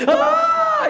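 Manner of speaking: sudden high, loud mock scream; imitated scream. Man being baptized in icy water, screaming. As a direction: yelp like ice water just hit. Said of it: "He's, like, screaming".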